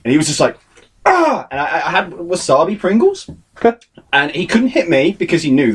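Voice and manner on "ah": short high-pitched yell; frustrated cry. Retold or imitated. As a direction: imitate an exasperated outburst in one cry. Imitating manager's enraged reaction.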